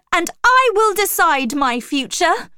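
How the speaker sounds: high, loud, emphatic assertion; defiant declaration. A narrator's reading. Jane asserts her freedom to Rochester, defiant. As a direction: proclaim it defiantly at high pitch.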